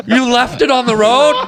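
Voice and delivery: high-pitched gasping disbelief; hammed-up horrified question; mock horror. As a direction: imitate her, appalled and near tears. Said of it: "her eyes start watering".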